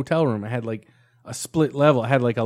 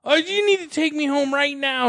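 startled protest; 12 semitones higher than usual. Retold or imitated. Retelling his startled reply to her yelling, high alarmed protest. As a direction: sound shocked at being yelled at at night. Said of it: "I was like".

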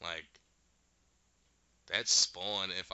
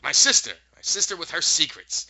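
bitter witch voice; 5 semitones higher, 10 dB louder than usual. Retold or imitated. Witch bitter about her sister.

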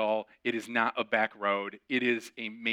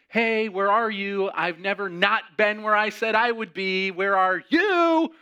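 much louder and higher, slow, building to a shout; angry phone call; 7 semitones higher, 6 dB louder, slower than usual. Retold or imitated. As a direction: demand answers down the phone.

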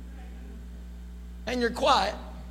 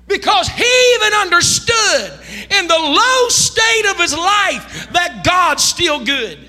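full-force preaching; 6 semitones higher, slower than usual. Acted. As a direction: lift the church up, draw every word out.

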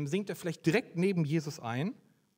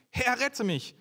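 high, loud cry for help; 8 semitones higher, 3 dB louder, slower than usual. A narrator's reading. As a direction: call out like a man sinking. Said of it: "schrie er". (hoher, lauter Hilfeschrei)

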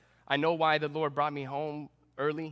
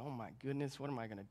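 much softer, a mild hint of helpless panic; panicked murmur; 3 semitones lower, 11 dB quieter, faster than usual. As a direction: mutter to yourself, lost at a grieving family's side.

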